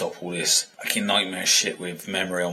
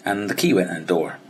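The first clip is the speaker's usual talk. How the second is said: usual speaking voice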